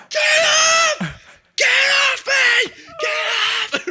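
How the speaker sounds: shrill screaming, high and loud. Retold or imitated. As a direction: scream for them to let go. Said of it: "I'm just screaming".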